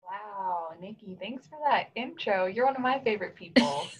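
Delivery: ordinary talk, nothing marked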